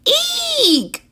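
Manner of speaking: very high startled shriek; Mama Bird sees her babies hanging; shrieking eek. A narrator's reading. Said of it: "she cried".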